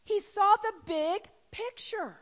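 loud, high-pitched sermon climax; impassioned preaching. Real, not acted. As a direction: preach it out with full force. Preaches at full force.

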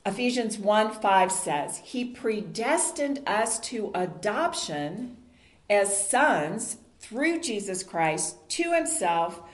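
usual speaking voice

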